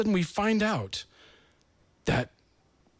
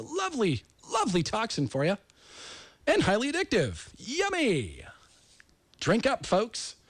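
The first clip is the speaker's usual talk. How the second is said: higher, mock-sweet sales pitch; sarcastic; 6 semitones higher than usual. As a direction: sell the poison with fake delight. Sarcastic praise of Diet Coke.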